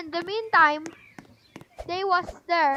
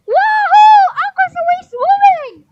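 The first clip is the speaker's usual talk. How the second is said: high, loud whoop of thrill on the ride; excited ride scream; 12 semitones higher, 13 dB louder than usual. Acted. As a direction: shriek with delight as the ride starts. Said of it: "they're screaming like a baby".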